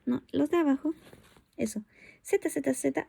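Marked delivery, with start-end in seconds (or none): none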